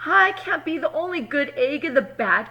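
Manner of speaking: loud, high firm outburst; determined declaration. Acted. Egg announcing to the carton she is done, loud. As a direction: declare it, fed up.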